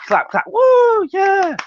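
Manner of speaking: high whooping cheer; excited crowd cheer. Retold or imitated. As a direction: whoop like a fired-up fan. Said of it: "go like that".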